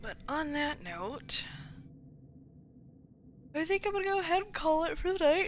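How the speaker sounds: talking through a yawn; yawning between the words. Real, not acted. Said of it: "I keep yawning".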